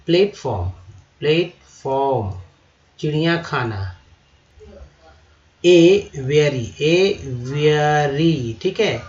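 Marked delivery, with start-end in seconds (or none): 5.7-8.6 एवियरी said slowly and loudly, four clear sounds; slow careful model. Shown as a demonstration. Teacher warning against एवरी, loud slow model. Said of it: "एवरी बोल... वो नहीं बोलना है".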